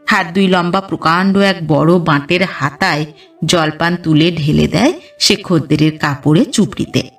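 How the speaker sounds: usual speaking voice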